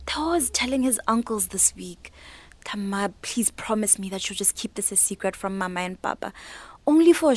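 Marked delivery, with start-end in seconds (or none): 2.6-6.3 softer pleading whisper. Acted. Neo begging her aunt to keep the secret, whispered plea. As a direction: hush it so the parents won't hear.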